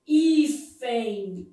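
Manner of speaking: "hífen" drawn out in two syllables; teacher sounding out "hí-fen"; demonstrated diphthong. Shown as a demonstration. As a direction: stretch "hífen", ending in a nasal "ein" glide. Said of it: "Aqui eu tenho um ditongo".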